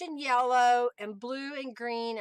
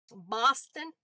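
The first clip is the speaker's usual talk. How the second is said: put-on Boston accent. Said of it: "A New England accent and a Bos-, Boston accent".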